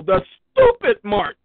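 loud, slowed mocking sneer at the stupid mart; drawn-out loud put-down; mocking jab. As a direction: spit it out with scorn.

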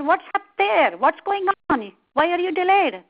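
loud, high impatient questions; impatient calling up. A narrator's reading. Crocodile calls to monkey up the tree, impatient. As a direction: call up to someone out of reach.

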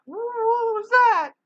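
very high, slow, scared little voice; a tiny piglet panicking; frightened squeak. Acted.

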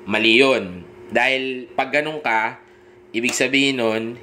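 usual speaking voice

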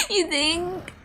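high, loud laughing question. Real, not acted. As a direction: laugh it out in disbelief. Laughing at a cat comparison.

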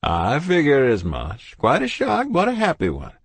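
put-on jovial old doctor, booming hello; kindly old doctor voice; cheery doctor. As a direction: greet them like a beaming family doctor. Doctor responds.

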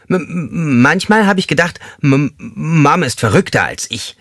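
high voice stumbling over the m sounds; stuttering inmate talking about his mom. A narrator's reading. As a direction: stutter nervously on the first words. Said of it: "wie das Stottern wiederkehrte".

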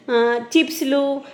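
ordinary delivery

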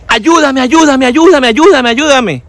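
high mock pleading, run together; pedigüeño insistente. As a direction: nag for help in a high put-on voice. Imita al pedigüeño que insiste. (súplica insistente con voz aguda fingida)

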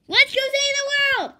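high, loud heroic battle cry; rallying cry. Acted. Character rallies the team to action, rousing shout. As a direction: belt it out as a call to action.

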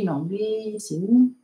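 ordinary delivery

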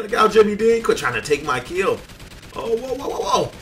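loud, high urgent shouting; frantic protest. Real, not acted. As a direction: yell at a rival stealing your moment. Rival about to take his kill.

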